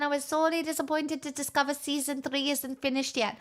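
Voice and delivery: ordinary talk, nothing marked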